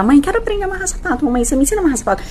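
put-on fast, high-pitched pleading kid; eager child. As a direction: imitate a child pestering mum, racing along. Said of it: "Todo dia". (criança pedindo, voz aguda)

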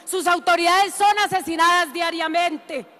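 ordinary delivery

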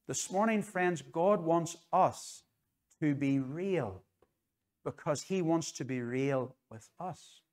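very slow, measured pleading to the congregation; slow, sincere preacher's appeal; slow earnest appeal. Real, not acted. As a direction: drag every word out like a heartfelt plea.

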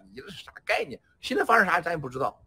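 usual speaking voice

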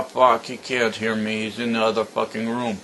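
ordinary talk

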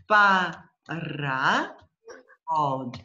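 "pa" loud, then "ra", a pause, "hod"; syllable by syllable. Shown as a demonstration. Teacher shows next way of separating, syllable by syllable. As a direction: give "pa, ra, hod" piece by piece. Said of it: "separating the words in syllable".

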